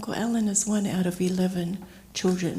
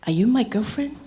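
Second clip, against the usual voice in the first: retold cheeky question, pitched up; cheeky humour; 4 semitones higher, 4 dB louder than usual. Uncle teasing his niece in the kitchen, cheeky. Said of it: "indicative of his cheeky humor".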